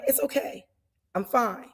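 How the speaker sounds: hushed, calm brush-off, trailing to a whisper; calm and forgiving. Retold or imitated. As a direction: play it down softly, no big deal. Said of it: "so forgiving, so calm".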